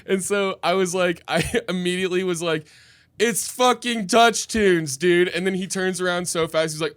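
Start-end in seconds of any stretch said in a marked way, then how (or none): 3.2-5.3 exasperated. Retold or imitated. Exasperated reply retold.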